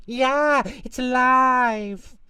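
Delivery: very high, loud shriek, stretched slow; terrified scream. Acted. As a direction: scream as if the doll came alive. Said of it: "The man screamed".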